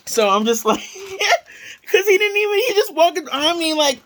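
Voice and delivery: high, laughing through the words; cracking up mid-story. Real, not acted. Telling viewers about the manager, laughing hard.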